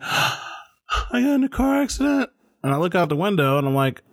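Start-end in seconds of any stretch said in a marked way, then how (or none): 0.0-2.3 high, upset voice; high, upset imitation of a crying girlfriend; panicked girlfriend. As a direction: voice a hysterical pregnant partner. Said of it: "She's like, gasps".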